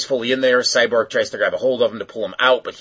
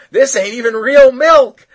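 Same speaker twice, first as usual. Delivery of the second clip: high, loud, laughing mock gripe; hammed-up outraged squeak; mock Cyborg complaint; 13 semitones higher, 12 dB louder than usual.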